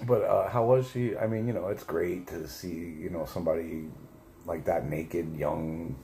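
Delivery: ordinary talk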